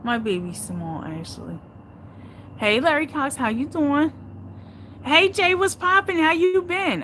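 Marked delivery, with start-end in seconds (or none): 2.6-7.0 high, loud, bubbly hello to viewers; light up as each viewer joins; excited greeting. Real, not acted. Streamer greeting viewers by name, high and loud.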